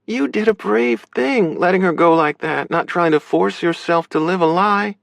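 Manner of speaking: high-pitched sister character voice; accepting, high female voice; supportive sister. Acted. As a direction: play her high, encouraging him.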